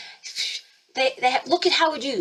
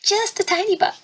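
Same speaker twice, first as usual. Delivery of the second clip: "Just a tiny bit" in a much higher, squeaky joke voice; joking squeaky voice; 11 semitones higher than usual. Retold or imitated. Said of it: "And then it's a joke. He's like".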